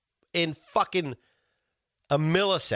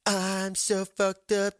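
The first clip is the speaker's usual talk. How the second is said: mocking; 8 semitones higher, much slower than usual.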